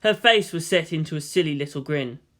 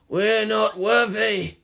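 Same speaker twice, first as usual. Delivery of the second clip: slow, slightly higher bowing chant; mock reverent; 5 semitones higher, slower than usual. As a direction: grovel in hammed-up awe. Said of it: "in between kowtows".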